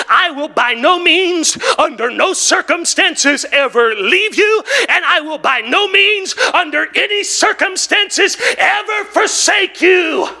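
emphatic Greek paraphrase; emphatic rendering. Shown as a demonstration. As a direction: drive home by no means, rising. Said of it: "it would sound something like this".

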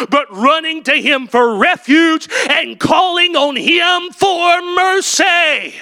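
climbing into a high, slow shouted plea; preaching at full force. Acted. Preacher to his congregation, rising shouted appeal. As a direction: rise to a cry on mercy.

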